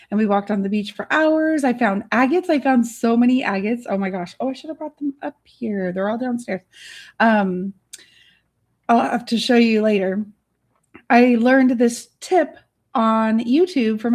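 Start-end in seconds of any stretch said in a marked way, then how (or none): none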